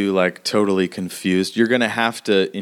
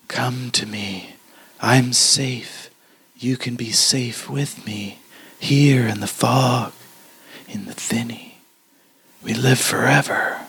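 ghostly whisper; 3 semitones higher than usual. Acted. Ghostly fog voice luring.

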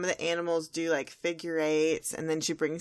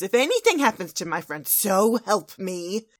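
threatening warning; 2 semitones higher, 8 dB louder than usual. Acted. Fluttershy in Twilight's face, loud mock threat. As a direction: rush in loudly, then stretch the last words. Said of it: "turns to Twilight, gets a hoof right in her face".

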